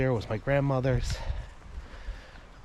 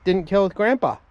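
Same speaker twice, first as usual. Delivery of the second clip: excited; 8 semitones higher, 6 dB louder than usual. Real, not acted. Telling his daughter about the turkey.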